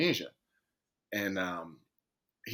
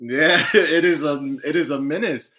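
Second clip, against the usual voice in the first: laughing; 4 semitones higher, 8 dB louder than usual. Real, not acted. Laughing at his own ego.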